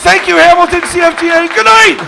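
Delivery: loud, high call to the crowd; big yelled farewell from the stage; shouted sign-off. Real, not acted. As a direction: holler goodnight over the applause.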